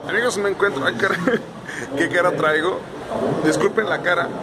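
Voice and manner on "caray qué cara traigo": ordinary delivery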